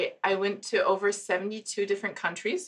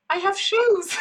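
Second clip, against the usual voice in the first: sitcom character; 12 semitones higher, 4 dB louder, slower than usual. Retold or imitated. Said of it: "And she says".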